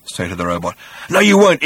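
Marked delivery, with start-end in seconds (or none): 1.1-1.6 much higher, louder refusal; loud insistent no. A narrator's reading. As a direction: push the no up hard, insisting. Zaphod defies the robot again.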